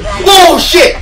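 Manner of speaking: high, very loud shriek; startled scream. Real, not acted. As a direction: scream at a sudden jump scare. Jump scare in horror game.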